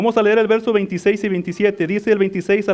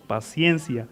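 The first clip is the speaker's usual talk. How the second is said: soft answer; 8 semitones lower, 7 dB quieter, slower than usual. Acted. Preacher answers his own question.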